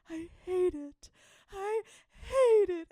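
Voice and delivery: high, loud wail of "I hate it"; wailed near tears, hammed up in the retelling; retold distraught wail. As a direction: stretch it out, escalating to a mock-tearful cry. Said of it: "trying not to cry".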